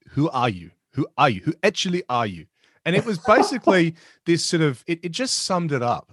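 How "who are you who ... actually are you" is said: mock South African accent. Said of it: "it is outstanding".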